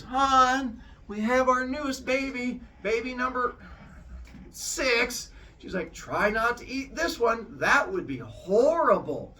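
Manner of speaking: high, sing-out voice of an acting wife; hammed-up innocence, pitched high; put-on wifely announcement. Wife presenting a fake baby to husband, hammed-up.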